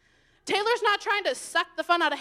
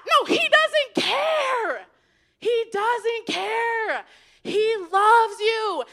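slow, much higher, loud and stretched; passionate preaching; 5 semitones higher, 5 dB louder, much slower than usual. Real, not acted. Preacher to the congregation, slowly and fervently. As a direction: drag each word out with fervor. Preacher proclaiming God's love.

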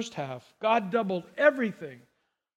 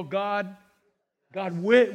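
ordinary talk

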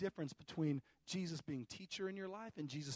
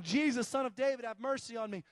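raised, higher cry for mercy; acted shout, easing slightly at the end; imitated shouting; 4 semitones higher, 7 dB louder than usual. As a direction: call out as the beggar in the crowd. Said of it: "this guy is shouting".

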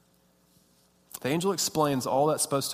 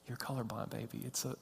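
very soft, hushed reply; gentle correction; 3 semitones lower, 16 dB quieter than usual. Retold or imitated. Wife tells colorblind husband it's a line, gently hushed. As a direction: say it quietly, almost under your breath.

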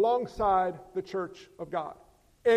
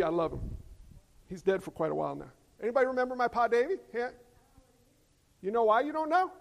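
usual speaking voice

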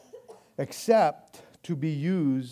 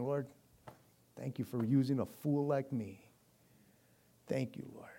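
hushed prayer; 4 semitones lower, 10 dB quieter than usual. Retold or imitated. A humble prayer quoted.

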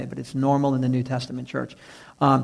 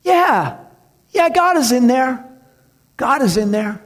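high, loud answer across the fence; calling back to a kid far away; pastor calling back; 8 semitones higher, 4 dB louder than usual. Retold or imitated. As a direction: shout the answer out to him. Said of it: "The pastor had to stop and go".

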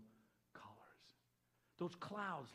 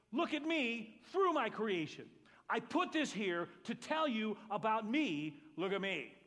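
emphatic call; 5 semitones higher, 9 dB louder, slower than usual. Acted. Voicing God, slow and emphatic.

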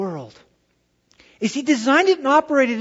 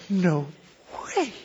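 hushed awe; 3 semitones lower, 5 dB quieter than usual. Retold or imitated. Kid's awestruck reaction after gasp.

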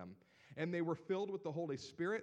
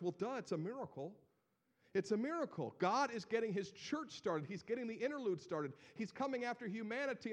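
usual speaking voice

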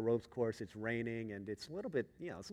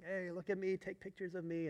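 put-on kids calling out; 7 semitones higher, 3 dB quieter than usual. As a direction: lightly imitate children showing off. Kids showing off up high.

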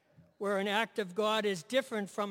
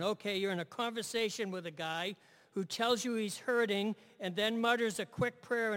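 normal talking voice

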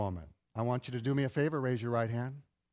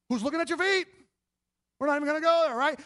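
dismissive husband; 11 semitones higher, 4 dB louder, faster than usual. Retold or imitated.

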